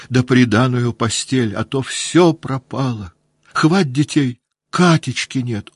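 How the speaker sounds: high, tearful, breaking lament; sobbing account. A narrator's reading. Woman tells Pierre about the fire, tearful and high. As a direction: pour out the story between sobs. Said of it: "скороговоркой всхлипывая".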